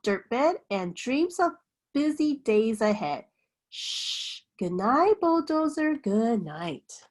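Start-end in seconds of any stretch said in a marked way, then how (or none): 3.7-4.4 gentle unvoiced shh; a quiet shushing breath; soft shush. A narrator's reading. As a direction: hush a sleepy child. Hushing the truck to sleep.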